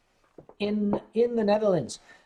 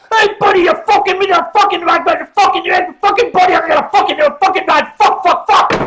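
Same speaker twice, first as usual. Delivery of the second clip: higher and much louder, sputtering Newfoundland rage; imitating a boat captain screaming curses, much louder and higher; furious shout; 11 semitones higher, 12 dB louder than usual. Captain yelling at the boat that rammed his stern, furious shout.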